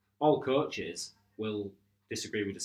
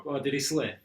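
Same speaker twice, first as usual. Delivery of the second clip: mock-innocent question, pitched up; sarcastic; 6 semitones higher, 7 dB louder than usual. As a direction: fake concern, dripping sarcasm. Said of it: "you sarcastically go to him".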